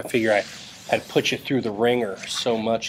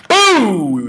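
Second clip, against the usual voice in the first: triumphant shout; 10 semitones higher, 9 dB louder than usual. Real, not acted. Mock celebration after dictionary check.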